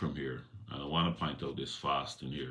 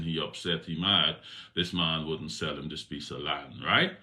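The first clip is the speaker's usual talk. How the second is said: normal talking voice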